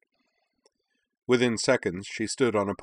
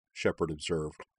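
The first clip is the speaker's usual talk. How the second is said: ordinary delivery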